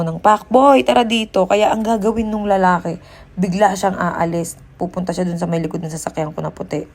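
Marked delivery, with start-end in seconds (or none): 0.2-1.4 called out loud and high, half-real; shouted call. Retold or imitated. Vlogger recalls shouting to scare off stranger, loud call. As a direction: yell for the man as if he were there. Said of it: "Sumigaw ako ng".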